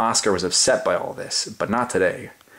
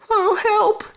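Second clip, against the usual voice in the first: very high, louder yelp for help; panicked cry; 4 dB louder than usual. Acted. Cats fleeing Oscar, high panicked yelp. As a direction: yelp like a startled cat. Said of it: "cried the cats".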